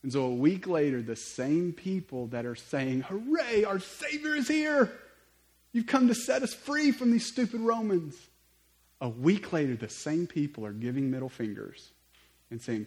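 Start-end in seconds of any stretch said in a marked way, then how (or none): 3.0-8.1 high-pitched, loud mock crowd cheer. Same people welcome their Savior, hammed-up jubilant cheering. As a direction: hail the rescuer in an exaggerated high shout.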